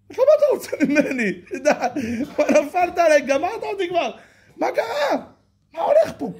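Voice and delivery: fast, high, laughing protest; flustered outburst. Acted. Rachel protesting to the boss, fast high laughing outburst. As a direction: blurt it out half laughing, overwhelmed.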